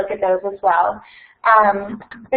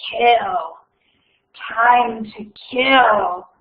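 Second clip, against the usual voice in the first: very slow, drawn-out menace; cold murderous voice; much slower than usual. Acted. The monster in the walls, very slow and menacing. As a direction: stretch each word like a hungry monster. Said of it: "cold, murderous voice".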